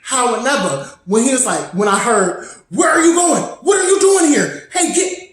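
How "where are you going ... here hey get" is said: retold shouting. Ex shouting at an intruder.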